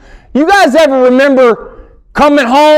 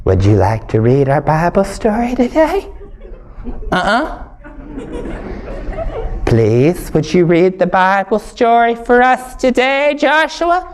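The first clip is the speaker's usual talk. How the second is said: softer, coaxing teacher; sweet teacher voice; 3 semitones lower, 6 dB quieter than usual. Retold or imitated. Teacher coaxing the boy to read, soft and sweet. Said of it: "She goes".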